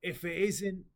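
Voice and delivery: lightly voiced smug broker; a hint of a sly dealer greeting them; smug NPC voice.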